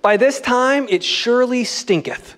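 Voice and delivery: hammed-up archaic Bible line; old translation reading. Preacher quoting Martha from an old translation, mock-grand. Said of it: "you should read some of the translations".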